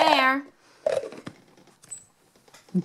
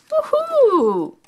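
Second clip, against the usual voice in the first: cheer; 12 semitones higher than usual. Acted. To the kids watching, an excited high cheer. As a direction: celebrate finding the pup.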